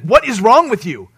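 incredulous scold. Pretend. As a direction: teammates shouting at the moping water boy, incredulous. Teammates scold the water boy.

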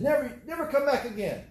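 retold clerk banishing a thief; banishing order. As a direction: order her never to return. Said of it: "the lady said".

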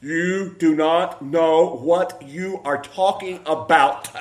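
very slow, every word separate; slow deliberate version. Shown as a demonstration. Preacher correcting himself, slow and measured. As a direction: spell out each word slowly and weightily. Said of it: "There, that's better".

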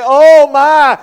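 sudden loud cry of panic before the crash; mock scream. Driver about to crash cries out in a loud panic. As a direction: blurt it out like the car is about to hit. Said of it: "you know what they're gonna scream out".

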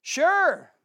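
loud, high, drawn-out retort; sceptical companion. A narrator's reading. Companion answers explorer facing a lion, loud high retort. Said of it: "said his companion".

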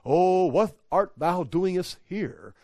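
slow, lofty King James parody; mock-solemn archaic voice. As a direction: draw it out with pompous reverence. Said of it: "It's not like".

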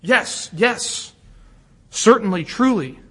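"Yes, yes" punched as separate beats, then a firm "certainly, truly"; emphatic. Acted. Preacher affirming Christ comes for both, emphatic on each word. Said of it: "and it's emphatic".

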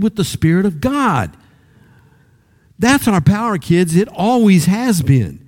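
high, ringing, impassioned preaching; preaching at full force. Real, not acted. Pastor urging the kids to repent, preaching at full force.

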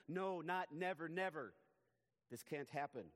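slow, very loud No, not never, never; double emphasis. Acted. Peter refusing Jesus's fate, loud slow emphatic never. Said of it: "it's a double emphasis".

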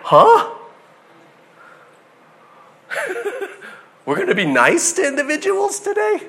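high, laughing disbelief; a baffled passenger who can't believe the driver is being nice; mock disbelief. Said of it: "the person sitting in the passenger seat would be like".

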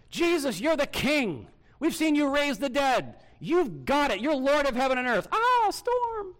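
high, loud cries of alarm; panicked disciples. Acted. Disciples crying out to Jesus in the storm, panicked shouting. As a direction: shout it frantically, voice shooting up.

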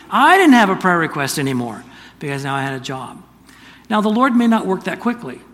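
loud, rising sermon build; preaching. Acted. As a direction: drive the point home to the congregation. Preaching at full force.

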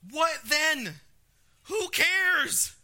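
high, loud, dismissive cry; defiant outburst. Acted. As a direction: throw it out loud and high, not caring. Acting out Paul's defiant response.

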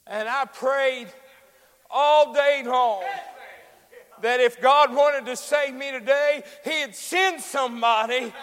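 loud, high, slow preaching; rising preaching. Acted. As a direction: build to a joyful climax. Sermon climax.

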